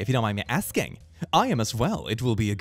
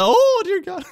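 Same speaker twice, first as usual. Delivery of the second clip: high, loud shocked exclaim; 9 dB louder, slower than usual. Real, not acted. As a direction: recoil in shock. Reacting to an offensive tweet.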